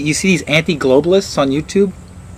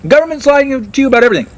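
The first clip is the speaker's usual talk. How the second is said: high, loud, fast ranting crank; mock conspiracy nut; 13 semitones higher, 4 dB louder, faster than usual. Mocking conspiracy theorists to the audience, a high fast rant. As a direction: rant like a frantic truther.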